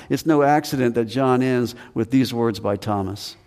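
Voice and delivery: ordinary delivery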